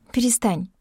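ordinary delivery, nothing marked

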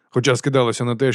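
plain reading voice

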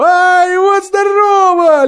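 high, loud, drawn-out cheer; exultant boyish whoop; jubilant. A narrator's reading. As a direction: shout it with joy, stretching the words. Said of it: "ликовал он".

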